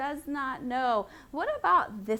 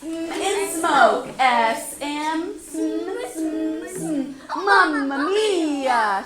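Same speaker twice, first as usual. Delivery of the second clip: loud Italian baker voice; 3 semitones higher, 5 dB louder, much slower than usual. Shown as a demonstration. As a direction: say it like a jolly baker. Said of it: "Let's be a baker".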